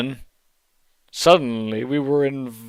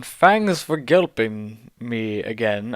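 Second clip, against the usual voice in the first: usual speaking voice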